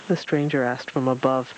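ordinary delivery

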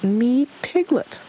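slow, high squeaky voice; small squeaky creature introducing itself; Piglet. A narrator's reading. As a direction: squeak it slowly, pitched well up. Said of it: "the squeaky voice said".

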